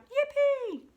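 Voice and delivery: high, loud whoop; gleeful cry. A narrator's reading. Tyrannosauruses seeing a bridge appear, gleeful loud cry. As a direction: whoop it out in celebration. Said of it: "cried out".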